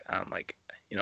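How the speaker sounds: soft unvoiced whisper, dropping the voice; whispered aside. Real, not acted. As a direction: slip it in under your breath. Whispered aside while retelling.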